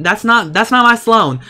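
high, loud objection; indignant protest. Real, not acted. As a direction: insist that the replacement is wrong. Rejects the replacement Sim.

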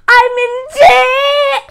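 high, loud, slow crying shout; distressed wail. Real, not acted. Player realising she is in debt in the game, wailing high, loud and slow. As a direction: wail it out in panic.

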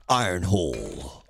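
deep, slow robot leader voice; Optimus Prime impression. Streamer imitating how the transformer leader talked. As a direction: drop low and slow like Prime. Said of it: "he talked like that".

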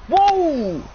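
high, swooping cry of surprise; astonished whoa. Real, not acted. Player reacting to the game action, astonished cry. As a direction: let the whoa leap up, then fall.

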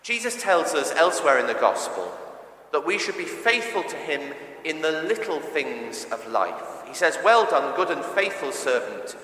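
ordinary delivery

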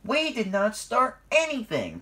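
high, loud indignant protest; outraged denial; heated retort. A narrator's reading. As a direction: fire back in a high voice. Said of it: "Percy shot back".

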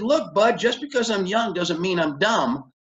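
high-pitched, heated retort; acted indignation. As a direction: re-enact telling the lender off. Acted angry retort before storming out.

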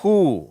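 "ஹூ" begun on h, pitched high; teacher's high, falling "ஹூ"; H sound, no W. Shown as a demonstration. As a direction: model "ஹூ" with no w at all. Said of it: "அந்த வ்வுன்ற உச்சரிப்பே வராது".

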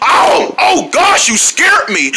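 high startled exclamation; startled yelp. Acted. Startled by someone.

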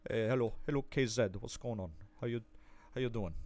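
low, soft attempt at an English accent; badly faked English accent. Streamer imitating an English guide writer, badly faked English accent. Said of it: "I don't know how to do his accent".

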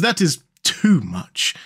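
drawn-out delighted protest; ecstatic. A narrator's reading. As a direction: savour it, overwhelmed with delight. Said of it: "cried the Mole in ecstasies".

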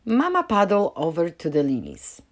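plain reading voice

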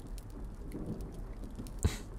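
short gentle chuckle; quiet reassuring laugh; soft laugh. Acted.

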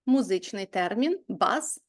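ordinary talk, nothing marked

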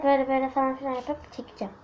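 whispered plan. Real, not acted. Girl whispering to viewers how she will draw one. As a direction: whisper it like a secret.

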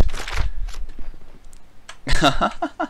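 soft, breaking-up chuckle. Real, not acted. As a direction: laugh softly to yourself. Amused after vowing to annihilate.

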